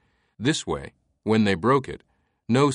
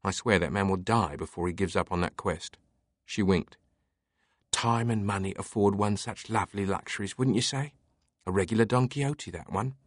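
lightly voiced amused librarian; 4 dB quieter than usual.

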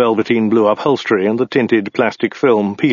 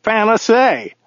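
high voice for the driver; driver character; 12 semitones higher, slower than usual. A narrator's reading. Driver assuring his passenger, high character voice.

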